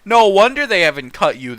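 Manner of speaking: normal talking voice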